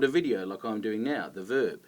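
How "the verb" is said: normal talking voice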